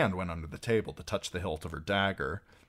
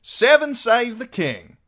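loud, high, drawn-out call; cheerful toast; 13 dB louder than usual. A narrator's reading. Archer toasting the king, loud cheerful call. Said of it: "called out cheerfully, lifting a toast".

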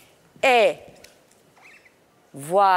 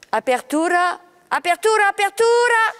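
urging coach; 10 semitones higher, faster than usual. Real, not acted. Ballet teacher urging a dancer to open the arms, rising. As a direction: push her to open up, more each time. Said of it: "coaching the opening, rising".

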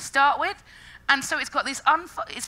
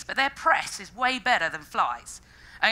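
ordinary talk, nothing marked

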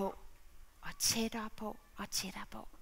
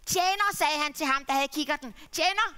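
higher, louder calling out; eager father calling; father calling servant; 5 semitones higher, 8 dB louder than usual. Acted. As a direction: call to the servant across the yard.

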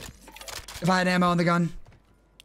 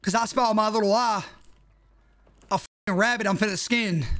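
ordinary talk, nothing marked

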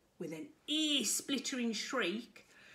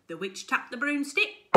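ordinary delivery

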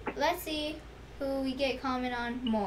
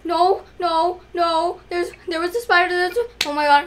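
loud, fast rattled babble; shaken panic; 4 semitones higher, 9 dB louder than usual. Real, not acted. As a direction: still panicking, calming down. Still shaken after spider.

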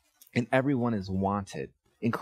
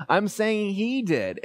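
imitating son protesting; 9 semitones higher, slower than usual.

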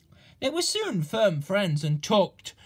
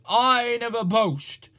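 loud, slow, pompous false modesty; mock modesty; 4 semitones higher, 6 dB louder than usual. A narrator's reading. Gordon pompously boasting to Duck, slow and loud. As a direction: boast while claiming not to. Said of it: "continued Gordon modestly".

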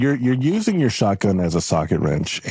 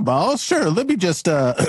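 ordinary talk

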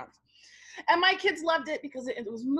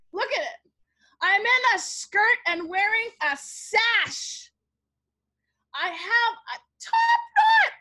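loud, slow, climbing to a squeal; hammed-up appalled outcry; mock horror; 6 semitones higher, 5 dB louder, slower than usual. Real, not acted. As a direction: draw out each embarrassing detail, rising to a high shout. Mock horror at her old photo.

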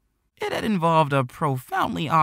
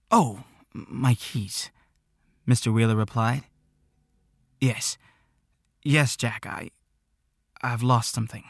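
flustered. A narrator's reading. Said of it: "Mister Wheeler was flustered".

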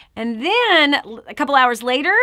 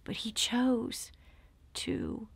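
hushed confession; 9 dB quieter than usual. Real, not acted. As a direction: confide it barely aloud.

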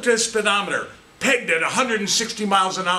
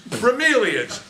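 ordinary delivery

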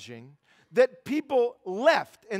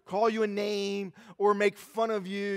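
normal talking voice

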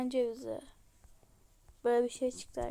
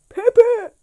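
shout; 10 semitones higher, 19 dB louder than usual. Real, not acted. Exclaiming a surprising item.

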